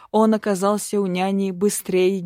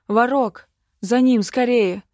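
raised, urgent shout to a companion; urgent call; 4 semitones higher, 6 dB louder than usual. Acted. Calling companion to chase rider.

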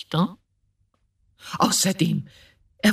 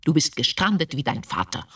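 ordinary talk

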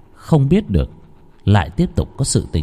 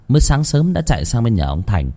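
ordinary delivery, nothing marked

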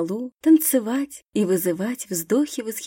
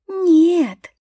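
sharp indignant no, high and loud; 8 semitones higher, 6 dB louder than usual. Acted. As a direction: scoff at her. Возмущённая Грета фыркнула. (возмущённо)

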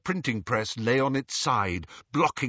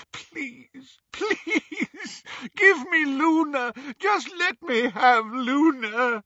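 high, whimpering then wailing; sobbing plea; 13 semitones higher, 4 dB louder, much slower than usual. A narrator's reading. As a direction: beg tearfully, building from faint to loud. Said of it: "sobbed Xenophilius".

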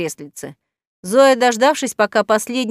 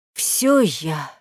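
sighing reply; 5 semitones lower than usual. A narrator's reading. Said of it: "вздохнул кот".

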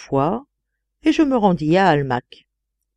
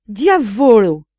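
loud, raised-pitch outburst; shouted oath; 4 semitones higher, 6 dB louder, slower than usual. A narrator's reading. Count reacting to the duchess's kisses, shouted oath. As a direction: cry it out as an indignant curse. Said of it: "cria le comte de Capricornuti".

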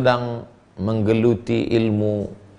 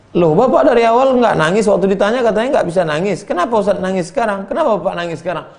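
high, loud, fast astonished questions; surprised questioning; 9 semitones higher, 3 dB louder, faster than usual. Acted. Pilgrims find the dry-eyed man weeping, high and astonished. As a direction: fire questions in amazement.

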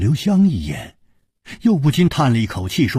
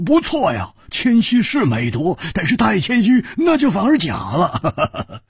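laughing tease; 8 semitones higher than usual. A narrator's reading. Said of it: "胡铁花笑着说".

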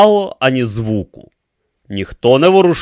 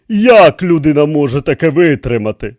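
high, loud astonished question; amazed; 8 semitones higher, 7 dB louder than usual. A narrator's reading. King asks how anyone endures it, amazed. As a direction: ask in raised-voice wonder. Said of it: "спитав вражений король".

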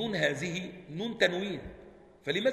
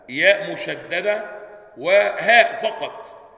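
slow and loud, each letter named with weight; spelling out letters; 3 semitones higher, 9 dB louder, much slower than usual. Shown as a demonstration. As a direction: drag out ياء مشددة and هاء for the class. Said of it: "همزة".

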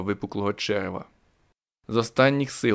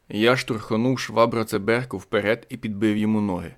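ordinary delivery, nothing marked

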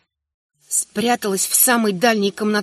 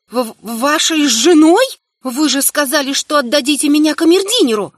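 higher, louder, stammering in disbelief; shocked heroine; 5 semitones higher, 4 dB louder than usual. A narrator's reading. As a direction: gasp it out, stunned. (потрясённо, выше и громче)

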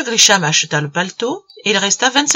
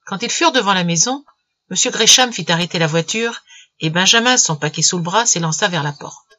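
plain reading voice

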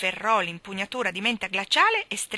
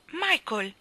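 higher-pitched call of surprise; surprised cry; 7 semitones higher than usual. Acted. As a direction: call his name with a start. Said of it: "gridò Jane con un moto di sorpresa".

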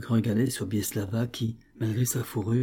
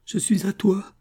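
high, breathy, raised cry; wild exaltation; 10 semitones higher, 5 dB louder than usual. A narrator's reading. Sobieslava gives herself to the officer, wildly exalted. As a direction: cry out in rapturous abandon. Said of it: "avec une sauvage exaltation".

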